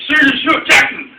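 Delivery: loud, high retold taunt; going off. Storyteller reenacts riling his angry friend, loud going off. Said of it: "I was going off at him".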